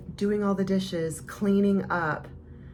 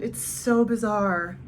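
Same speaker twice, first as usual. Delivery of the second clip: ordinary talk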